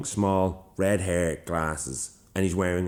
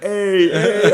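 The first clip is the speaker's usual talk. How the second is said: high, loud cheer of welcome; rowdy greeting; 13 semitones higher, 11 dB louder than usual. Retold or imitated. Crowd greets man walking in, mock rowdy cheer. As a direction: holler it like greeting a favourite regular. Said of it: "that scene in Goodfellas".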